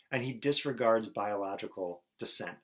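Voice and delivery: ordinary talk, nothing marked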